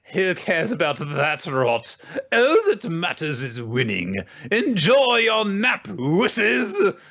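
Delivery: sneering rival. Retold or imitated. Rival player taunting Tom.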